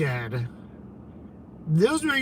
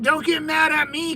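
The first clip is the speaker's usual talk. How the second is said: mock pleading; 7 semitones higher than usual. Real, not acted. Teasing an angry caller.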